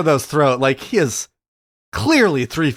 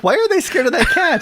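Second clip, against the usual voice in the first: put-on high-pitched puzzled kid question; imitating own kid; 13 semitones higher than usual. Parent quoting kid watching the show, high imitation. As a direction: pitch it way up as your child. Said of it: "He's like".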